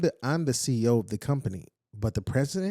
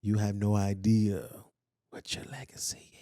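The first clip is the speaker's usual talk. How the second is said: Maya Angelou's deepest voice; 2 semitones lower than usual. Retold or imitated. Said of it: "Maya says in her deepest voice".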